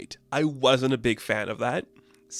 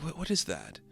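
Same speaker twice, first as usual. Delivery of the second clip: soft, hushed, startled question; 3 semitones higher, 9 dB quieter than usual. Retold or imitated. Marlene hearing a voice from a box, softly startled. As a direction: murmur it as if spooked. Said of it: "It's like".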